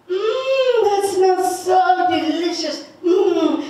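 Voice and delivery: a high, sing-song mmm of delight over the food; savoring mmm. Acted. Old man smelling roasted chili, sing-song delight.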